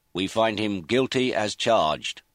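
loud, slow pronouncement; formal verdict. Acted. Foreman gives the verdict to the judge, loud formal announcement. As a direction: announce it to the whole court, drawn out.

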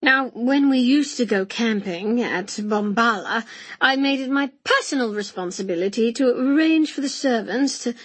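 hearty uncle holding forth, lively pitch swings; hearty old uncle. Acted. Uncle recalling camping days, hearty and talkative. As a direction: voice a reminiscing uncle with gusto.

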